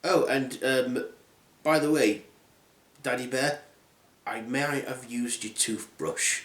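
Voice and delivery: soft, low, hesitant admission; sheepish confession. Acted. Girl admitting using the toothbrush, sheepish. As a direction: confess quietly, with awkward pauses.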